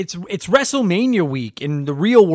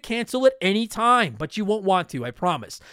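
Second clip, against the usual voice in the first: upbeat sponsor read, pitched higher; higher, brighter host-read commercial; 6 semitones higher than usual. Acted. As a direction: sell it like a podcast ad spot.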